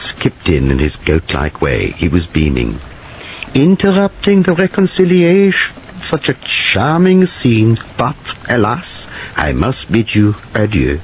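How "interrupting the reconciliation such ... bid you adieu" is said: high, theatrical foreign gentleman; flamboyant foreigner. Acted. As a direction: ham up the beaming charmer. Flamboyant Paravicini's voice.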